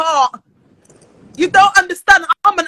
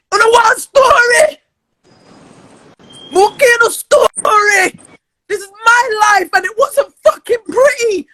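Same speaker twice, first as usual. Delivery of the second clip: crying while shouting; 3 semitones higher than usual. Real, not acted. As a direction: shout it through real tears. Tearful rant about her childhood.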